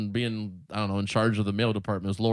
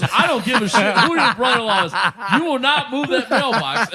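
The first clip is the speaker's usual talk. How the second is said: higher and louder, half-laughing scold; mock angry carrier; 10 semitones higher, 7 dB louder than usual. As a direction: bark it like an irate carrier, laughing through it.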